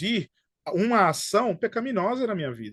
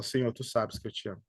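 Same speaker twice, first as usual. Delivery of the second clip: soft, much quieter, humbled confession; head bowed, sorrowful; 9 semitones lower, 10 dB quieter, faster than usual. Acted. Peter bows his head and answers Jesus, quiet and grieved. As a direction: say it low, with your head hung in shame. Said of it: "Pedro abaixa a cabeça e fala".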